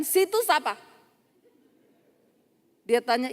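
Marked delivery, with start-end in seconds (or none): 0.1-0.8 high, curt imitated question; mock rude challenge. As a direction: ask it brusquely, as a challenge. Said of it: "Tadi kan".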